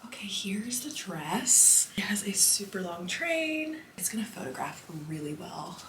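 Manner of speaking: whispering. Real, not acted. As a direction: whisper so nobody hears. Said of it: "I'm talking very quietly".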